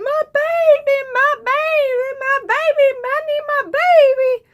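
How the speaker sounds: high, loud, desperate wail; frantic yelling. Retold or imitated. Woman in the wreck yelling for her baby, frantic high wail. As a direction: cry out for the missing baby. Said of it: "And she's just yelling".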